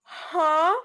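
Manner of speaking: high, loud, rising huh; incredulous huh. Retold or imitated. Girlfriend reacts to boyfriend's excuse, incredulous. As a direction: react in acted disbelief, pitch shooting up.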